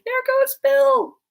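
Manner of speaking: high, drawn-out loud cry; slow, soaring shout; chorus shout. A narrator's reading. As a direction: call it out long and high like a crowd. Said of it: "a general chorus of".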